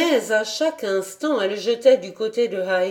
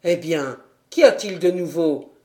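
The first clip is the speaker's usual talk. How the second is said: lower, lightly chuckling servant; amused butler; 4 semitones lower, 3 dB louder than usual. A narrator's reading. Butler asking the girl what happened, amused. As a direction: tease the girl kindly. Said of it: "demanda Sébastien en riant".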